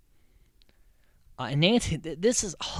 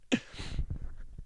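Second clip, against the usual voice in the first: breathy laugh; 7 dB quieter than usual. Real, not acted. After mock order.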